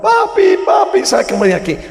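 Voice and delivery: put-on high, loud crying plea of a small boy; terrified child pleading. Priest voicing the locked-in boy begging his father, high and panicked. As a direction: scream for daddy to let you out.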